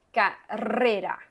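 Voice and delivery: teacher contrasting the two r's; strong first r. Shown as a demonstration. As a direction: roll the first rr of carrera, tap the second. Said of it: "la primera es como más fuerte".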